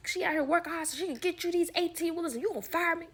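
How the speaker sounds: high, fast, sassy telling-off; imagined sassy rant. Pretend. Imagined rant at the boss who fired her, high and fast. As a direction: rattle off what she wished she'd said.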